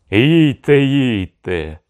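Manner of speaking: high, loud start that drops and stretches; goading send-off. A narrator's reading. Mereshka waving the envoys on to their doom, goading. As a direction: urge them off loudly, then ease down. Cossack mockingly sends envoys off.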